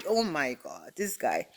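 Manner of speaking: normal talking voice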